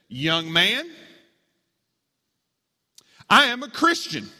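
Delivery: high, emphatic scolding; stern grandma. A narrator's reading. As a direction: lay down the law to an intruder. Grandma scolds an armed intruder.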